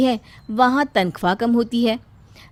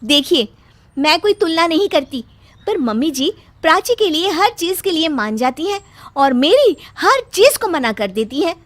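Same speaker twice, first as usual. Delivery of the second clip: higher, pressing objection; upset wife arguing; 7 semitones higher, 4 dB louder than usual. Acted. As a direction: insist she's treated unfairly. Bride argues with husband.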